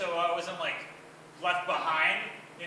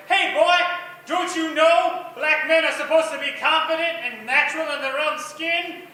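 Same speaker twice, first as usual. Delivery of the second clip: loud, higher mock scolding from a cranky old man; 6 semitones higher, 8 dB louder than usual. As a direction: yell at the boy like an angry old man.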